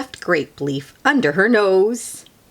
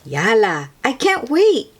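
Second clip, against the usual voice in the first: put-on louder, eager child call; eager shout; 5 semitones higher, 6 dB louder than usual. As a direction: call out that she can't wait. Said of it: "Lena shouted".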